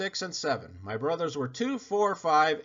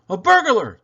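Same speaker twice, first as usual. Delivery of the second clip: loud high frightened cry; alarmed gasp; 7 semitones higher, 11 dB louder than usual. Acted. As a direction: gasp at the breaking glass. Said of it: "she gasped".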